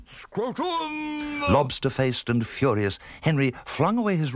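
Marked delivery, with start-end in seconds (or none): 0.0-1.4 high, strangled distant yell; shouting. Acted. Furious Sir Henry staggering up the garden, shouting. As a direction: yell it high and drawn out, as from afar. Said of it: "he was shouting".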